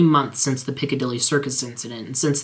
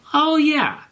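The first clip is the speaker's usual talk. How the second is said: Garfield remembering; 14 semitones higher than usual. Acted.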